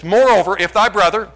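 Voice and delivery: loud, lifted scripture read. Acted. Pastor reading scripture aloud to church, proclaiming. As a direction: proclaim the verse to the congregation.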